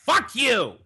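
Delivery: put-on high, loud drunken yell; sister's yell. Sister snapping awake, yelling at her brother, high and loud. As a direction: mimic the sister screaming it back. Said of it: "and she says".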